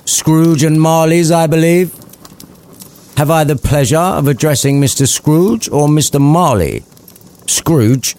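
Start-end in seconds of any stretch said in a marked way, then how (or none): 0.0-6.8 polite gentleman. Acted. Charity collector greeting Scrooge politely, higher. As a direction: address him formally.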